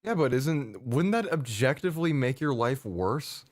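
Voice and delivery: ordinary talk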